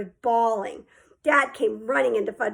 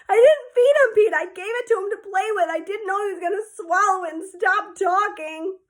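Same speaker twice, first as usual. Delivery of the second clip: mild tearful protest; 8 semitones higher, 5 dB louder than usual. Acted. Upset child defends himself.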